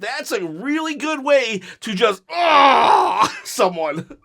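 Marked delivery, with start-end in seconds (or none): 2.3-3.4 loud, high drawn-out "ah"; loud cry as if driving a knife in; mock attack yell. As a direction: let out a big gutting yell, playfully. Acting out stabbing someone verbally.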